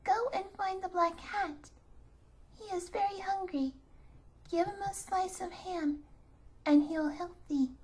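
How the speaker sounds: put-on tiny mice comforting the crying girl; squeaking mice. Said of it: "gratefully squeaked the mice".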